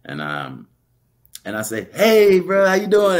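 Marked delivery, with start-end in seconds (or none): 1.9-3.2 much louder, higher shout on the run; calling out. Retold or imitated. Running past him, calling a loud greeting. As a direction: call it out in passing.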